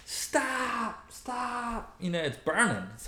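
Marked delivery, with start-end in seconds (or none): 0.1-2.0 high mock cry of stop stop; retold screaming. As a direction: squeal stop stop in a high pleading voice. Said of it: "I'm screaming".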